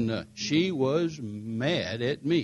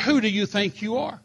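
higher, a bit louder indignant challenge; lightly voiced angry lady official; 8 semitones higher, 4 dB louder than usual. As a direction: demand it like an offended official. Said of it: "She said".

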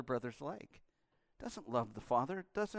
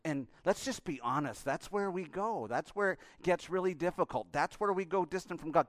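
ordinary delivery

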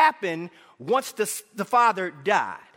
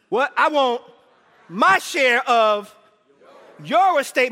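slow, loud, word-by-word demand; 2 semitones higher, 5 dB louder, slower than usual. Acted. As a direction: spell out the greedy demand slowly.

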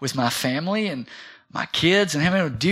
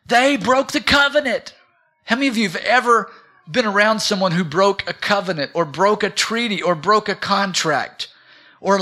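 raised, higher-pitched sermon delivery; impassioned preaching; 5 semitones higher, 4 dB louder than usual. Real, not acted. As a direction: drive each phrase home to the congregation. Preaches at full force.

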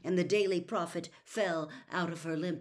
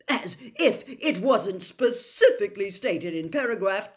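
loud, affronted complaint; indignant rant; 5 semitones higher, 12 dB louder than usual. A narrator's reading. Percy to his family about the Ministry, loudly indignant. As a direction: keep the outrage rolling.

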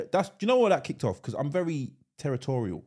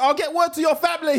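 much higher, mock-menacing tough-guy line; mock gangster threat; 13 semitones higher, 6 dB louder than usual. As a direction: play the street hard man for laughs. Mock threat after jokey street talk.

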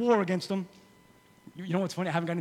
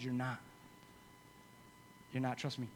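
much softer, lower serious aside; quiet; 7 semitones lower, 14 dB quieter than usual. Real, not acted. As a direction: lean in and level with them.

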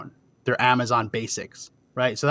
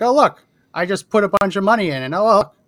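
higher, louder self-parody of showing off; mock bragging; 7 semitones higher, 5 dB louder than usual. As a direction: play the genius boasting to the wife. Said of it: "look like a genius to my wife".

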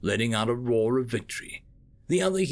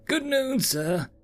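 much higher, small and eager voice; high-pitched nervous secretary; 14 semitones higher, slower than usual. Acted. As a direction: play the timid little mollusk assistant. Secretary greets boss.

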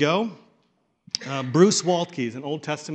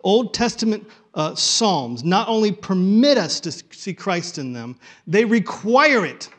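louder and higher, slowing on they require it, no real accent; powerful Luther quote; 4 semitones higher, 7 dB louder than usual. Shown as a demonstration. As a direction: proclaim it like a forceful old reformer. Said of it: "'cause it makes it so much more powerful".

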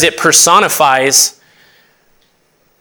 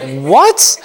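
mock disbelief. Preacher re-enacting his reply to coworkers' remark, mock disbelief. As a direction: pitch swooping sharply up on the question.

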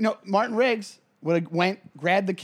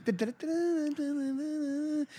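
high, soft manic humming; crazy hummed shuffle; 9 semitones higher, 7 dB quieter than usual. Retold or imitated. Riggs shuffling through the keys, humming high and softly like a madman. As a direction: hum along like a crazed cop rummaging through keys. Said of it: "crazy Martin Riggs going".

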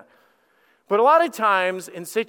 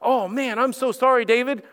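mock pity; 7 semitones higher, 4 dB louder than usual. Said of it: "like we do in church".

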